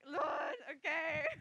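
tearful cry. Retold or imitated. Retelling emotional surrender prayer.

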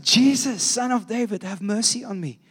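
high-pitched calling out, easing off; mock shout, held back in volume; retold shout. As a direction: imitate someone calling out for mercy. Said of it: "And he shouts out".

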